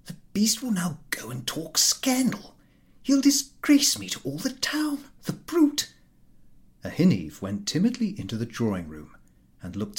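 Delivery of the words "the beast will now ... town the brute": high, whispery, anxious grumbling; fretful thought. A narrator's reading. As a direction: worry aloud to yourself. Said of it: "he thought".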